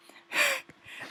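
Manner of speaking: sudden high squeal of laughter; high squeaky laugh. Real, not acted. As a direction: burst into a squeaky giggle. Laughs at own corn rant.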